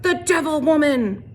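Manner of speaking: high, loud angry cry; accusing yell. A narrator's reading. Trout denouncing the teacher to the mob, high angry yell. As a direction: hurl the words as an accusation.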